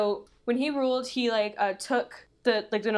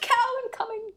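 mock alarm call. Announcing a launched dead cow.

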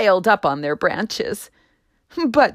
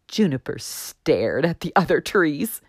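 usual speaking voice

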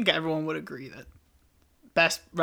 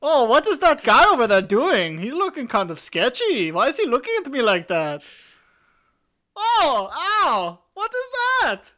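higher, louder mock archduke rising to a yelp; hammed-up archduke; 7 semitones higher, 6 dB louder than usual. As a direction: ham it up until the shot hits. Franz Ferdinand voiced, then shot.

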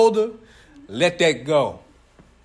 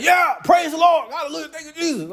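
imitating loud praise; 8 semitones higher than usual. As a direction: shout it like a rambunctious dad in church. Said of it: "He was like".

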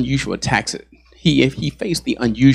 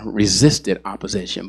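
normal talking voice

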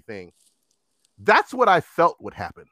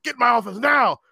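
angry manager; 7 semitones higher than usual. Retold or imitated. Acts manager ordering him inside.

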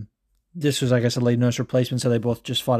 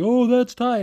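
much higher, louder hyped call; excited commentator; 11 semitones higher, 3 dB louder than usual. Retold or imitated. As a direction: sell the choke like a hyped commentator.

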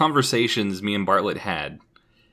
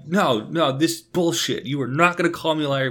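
higher, indignant mock outburst of a friend; imitating furious friend; 6 semitones higher than usual. Friend accused over a meme, mock indignant. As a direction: play the friend losing it, pitched up. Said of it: "Paul just loses his fucking mind".

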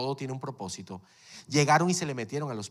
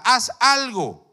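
emphatic command; 10 semitones higher, 11 dB louder, slower than usual. Real, not acted. Preacher's command.

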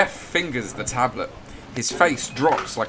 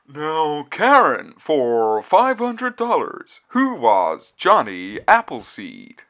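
loud, slow quiz host voice; milk the question like a radio host; game show host; 4 semitones higher, 6 dB louder, slower than usual. Acted.

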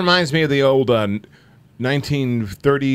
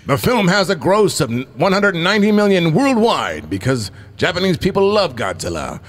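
ordinary delivery

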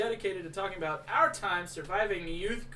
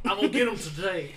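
smug cop voice; 6 dB louder than usual. Retold or imitated. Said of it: "they're like".

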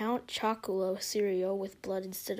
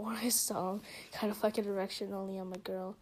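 usual speaking voice

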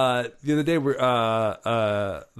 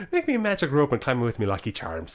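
hammed-up stage Irish; bad Irish accent. Shown as a demonstration. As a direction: recite a magic phrase in phony Irish. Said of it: "for a bad Irish accent".

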